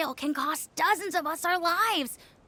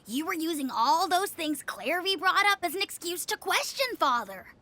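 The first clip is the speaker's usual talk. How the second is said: ordinary delivery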